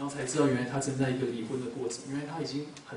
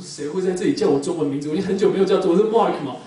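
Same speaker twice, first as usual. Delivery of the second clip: loud, surprised, half-laughing outburst; startled disbelief; 3 semitones higher, 12 dB louder than usual. Retold or imitated. Pastor startled to hear his Chinese name, loud surprise. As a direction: react like someone shouted your name out of nowhere.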